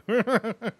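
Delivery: very high delighted cackle. Real, not acted. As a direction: cackle at own joke. Laugh after movie quote.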